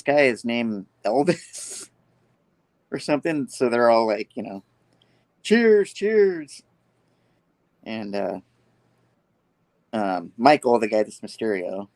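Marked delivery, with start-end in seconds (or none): 5.4-6.6 high, loud imitated toast; retold crowd cheer. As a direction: call it out like a group toasting. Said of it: "cheering each other on".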